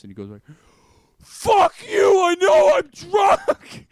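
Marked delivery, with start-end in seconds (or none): 1.2-3.9 much higher, very loud furious yell; angry drunk shout. Retold or imitated. Drunk kid yelling at his camera in mock fury. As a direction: scream it like a raging drunk teen. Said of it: "all angry after fucking gagging".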